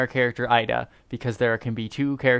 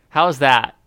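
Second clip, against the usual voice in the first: normal talking voice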